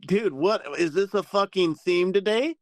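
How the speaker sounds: exasperated surprise, as if it keeps happening; retold exasperated disbelief; incredulous. Retelling his disbelief at being sent away.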